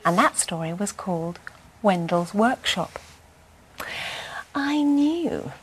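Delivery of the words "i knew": soft whispered aside; storyteller's closing aside; sly whisper. Acted. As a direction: confide the joke quietly. Closing joke.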